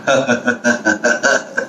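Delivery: hunter's staccato wicked chuckle; villain laugh. Acted. Hunter arrives in the story, villain laugh. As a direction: laugh like an old-movie villain. Hunter makes his entrance.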